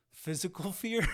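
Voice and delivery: real laugh bursting through the words. Real, not acted. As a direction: crack up while saying it. Laughs while telling the story.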